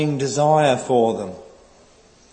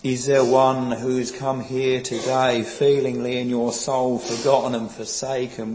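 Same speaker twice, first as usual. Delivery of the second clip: ordinary talk, nothing marked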